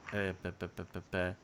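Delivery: usual speaking voice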